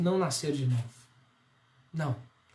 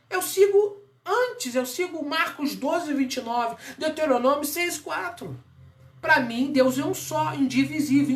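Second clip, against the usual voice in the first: fiery preaching; 8 semitones higher than usual. Acted. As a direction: preach it hard, pressing every point. Preaching at full force. (pregação inflamada, alta e enérgica)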